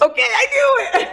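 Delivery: high, delighted exclamation; excited squeal of being right; gleeful vindication. Real, not acted. Gleeful reaction to the co-host's confession.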